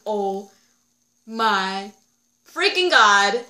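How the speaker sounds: loud, drawn-out cry of excitement; overwhelmed fan. Real, not acted. Fan gushing over Lydia remembering the love line, loud excited cry. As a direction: belt it out like the scene just broke you.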